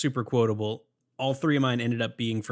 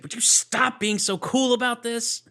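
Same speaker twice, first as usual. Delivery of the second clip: a high, slow, louder complaint, hammed up; mock frustration; 11 semitones higher, 3 dB louder, slower than usual. Quoting her losing it over his cool, in mock frustration. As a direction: stretch out her exasperation. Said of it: "she, like, lost".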